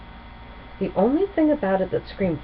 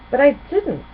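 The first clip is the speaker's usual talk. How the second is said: put-on higher, louder young dragon protest; a confused little dragon, pitched up; Spike's puzzled voice; 9 semitones higher, 6 dB louder than usual. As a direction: insist it wasn't him. Spike denies the present.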